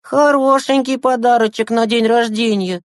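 put-on high boy voice, sarcastic; ironic remark. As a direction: say it with mock approval. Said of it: "иронично заметил Саша".

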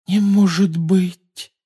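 high, drawn-out amazed gasp; hushed gasp of disbelief. A narrator's reading. Hermione on seeing the victory, gasping in amazement. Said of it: "тихо ахнула Гермиона".

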